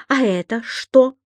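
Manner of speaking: high, emphatic accusation; acted outraged challenge; indignant. A narrator's reading. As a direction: confront him with the evidence, punching each phrase. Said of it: "негодовала госпожа Брюкман".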